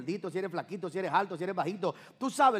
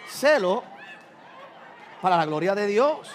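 full-force preacher's cry; preaching shout; 5 dB louder than usual. Real, not acted. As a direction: shout it out for God's glory.

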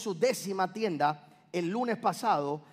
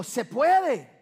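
higher, louder "it can be done"; retold amazed cry; excited exclaim; 5 semitones higher, 6 dB louder than usual. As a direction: burst out with sudden conviction. Retold inner exclamation, raised.

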